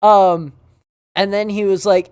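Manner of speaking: normal talking voice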